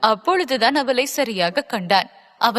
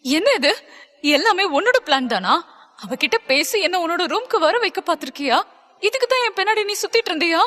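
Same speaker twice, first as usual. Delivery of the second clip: indignant, pitch climbing high; angry questioning; 6 semitones higher, faster than usual. A narrator's reading. Stella suspecting it was all his plan, angry and climbing. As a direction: interrogate him, getting higher and faster at the end. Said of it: "கோபமாக Stella கேட்க".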